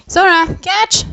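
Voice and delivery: high, loud call to Sora. Real, not acted. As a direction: toss it to Sora and shout it out. Calling out to Sora.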